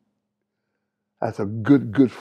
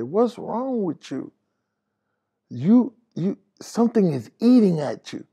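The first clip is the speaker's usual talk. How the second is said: concerned friend; 6 semitones higher than usual. Retold or imitated.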